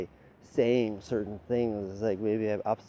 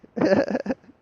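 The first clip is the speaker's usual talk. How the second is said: loud, high laughter; 12 semitones higher, 12 dB louder than usual. Real, not acted. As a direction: keep cracking up. Keeps laughing.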